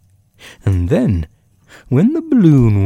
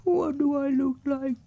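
very high, soft little bear voice; small squeaky bear, calling down gently; Winnie the Pooh; 9 dB quieter than usual. A narrator's reading. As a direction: ask from up high in a tiny, soft voice. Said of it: "shouted Winnie the Pooh down to you".

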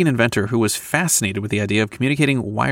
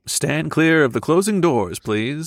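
tinny train announcement voice; muffled subway announcement; 4 semitones higher than usual. Retold or imitated. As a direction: announce the doors closing like a subway PA. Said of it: "the guy who says, "Stand clear," on a subway".

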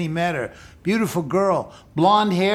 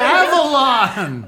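slow, loud, laughing shout of the title; excited eureka; 6 semitones higher, 6 dB louder, much slower than usual. Real, not acted. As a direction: blurt it out as it finally comes back. Remembers the movie title, bursting out.

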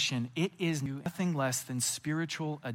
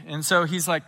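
ordinary talk, nothing marked

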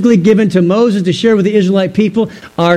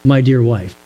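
tender; 6 semitones lower than usual. Shown as a demonstration. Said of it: "It's like saying".

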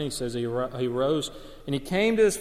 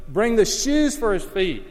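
joyful call; 6 semitones higher, 7 dB louder than usual. Acted. Father calling for shoes.

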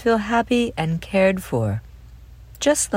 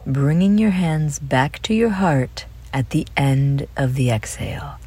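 guided breathing. Acted. Host leads children through dolphin breathing, calm guided meditation. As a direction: lead each breath calmly, leaving room to breathe.

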